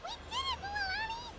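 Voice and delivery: high, bright cry of victory; cheering after a success; excited cheer. Acted.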